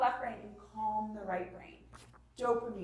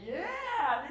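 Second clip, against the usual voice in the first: approving cheer; 6 semitones higher, 4 dB louder than usual. Retold or imitated. Wife cheering husband's empathy.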